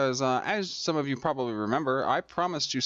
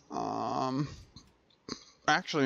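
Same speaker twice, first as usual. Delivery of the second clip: usual speaking voice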